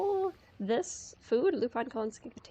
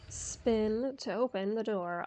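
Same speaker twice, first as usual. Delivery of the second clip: ordinary delivery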